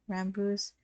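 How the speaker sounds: usual speaking voice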